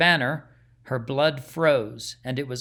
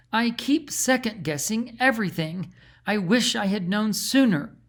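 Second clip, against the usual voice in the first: troubled princess; 7 semitones higher than usual. A narrator's reading. Karina voiced, distressed.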